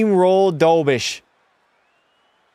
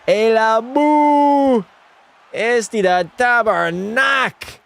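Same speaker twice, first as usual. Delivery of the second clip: put-on high, drawn-out French-accented cheer; 7 semitones higher, much slower than usual. Streamer celebrating a Montreal Canadiens goal, put-on French accent. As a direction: stretch the words in an excited French accent. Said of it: "Stop with the French accent".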